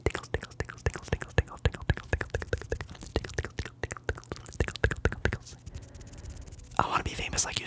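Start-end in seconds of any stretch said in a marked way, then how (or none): 0.0-5.6 rapid whispered Tingles in a looping stream; whispered trigger word. Shown as a demonstration. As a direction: let Tingles ripple out quick and close. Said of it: "Can I say tingles?".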